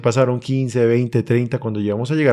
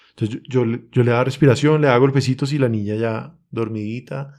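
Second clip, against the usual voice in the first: ordinary talk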